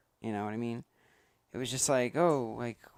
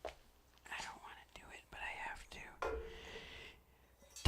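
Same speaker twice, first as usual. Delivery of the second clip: whispering; 13 dB quieter, much slower than usual. Acted. Reluctant before the toast.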